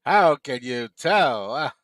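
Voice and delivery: slow, drawn-out put-on comedienne voice; slow, hammed-up comedienne delivery; Phyllis Diller. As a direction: stretch every word like Phyllis Diller. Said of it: "I can do a killer Phyllis Diller voice".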